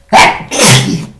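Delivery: real sneeze out of nowhere; sudden sneeze. Real, not acted. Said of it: "veio um espirro do nada".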